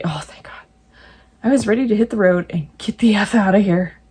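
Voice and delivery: ordinary delivery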